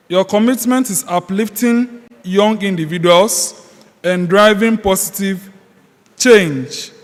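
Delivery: loud, ceremonial reading of a citation; reading aloud for the record, loud and formal. Real, not acted. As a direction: proclaim it to the hall like an official honor. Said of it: "may I read the contents of the citation".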